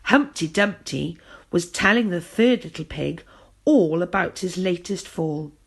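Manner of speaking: plain reading voice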